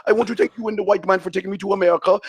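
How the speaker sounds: put-on broken-English African accent; mock African accent. Host answering an African caller, mock African accent.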